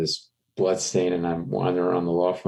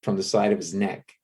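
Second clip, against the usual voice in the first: ordinary delivery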